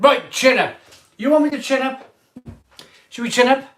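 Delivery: loud, high, incredulous retort; sarcastic outburst. Real, not acted. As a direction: throw the advice back at them, stung. Sarcastic retort to chat comment.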